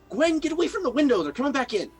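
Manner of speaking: urgent shout. Acted. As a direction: hiss a frantic warning. Urgent warning to Gwen.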